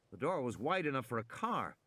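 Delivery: ordinary delivery, nothing marked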